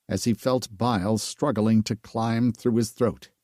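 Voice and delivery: ordinary delivery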